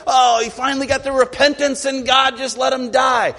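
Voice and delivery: high, loud mock dismay; imitating an indignant onlooker; mock disappointment. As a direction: play the let-down listener, hammed-up. Mock reaction of listeners.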